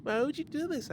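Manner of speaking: high whining complaint; high whine. Real, not acted. Whining at friend's uwu voice.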